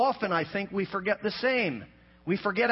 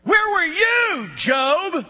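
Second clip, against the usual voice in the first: slow, much higher shouted WHERE; God's booming question; 7 semitones higher, 6 dB louder, much slower than usual. Acted. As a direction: thunder it out as God to Job. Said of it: "He says".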